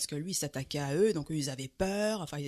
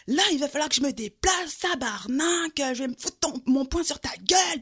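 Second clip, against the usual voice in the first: loud, much higher yelled threat; furious drunk threat; 8 semitones higher, 5 dB louder than usual. Retold or imitated. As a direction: yell a threat to punch her in the face. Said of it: "il commence à s'énerver".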